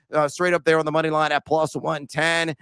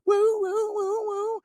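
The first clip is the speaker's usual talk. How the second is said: mock hoots; 3 dB quieter, slower than usual. Mock cheer about man-eaters.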